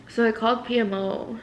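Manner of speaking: usual speaking voice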